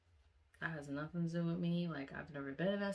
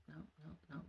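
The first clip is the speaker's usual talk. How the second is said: very soft, hurried refusal; anxious muttering; 14 dB quieter, much faster than usual. Retold or imitated. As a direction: mutter it under the breath. Trying to disregard the kid.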